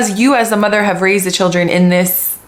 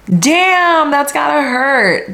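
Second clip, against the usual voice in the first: stunned reaction; 6 semitones higher, much slower than usual. Real, not acted. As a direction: feel the sting of it. Reacting to the kids' betrayal.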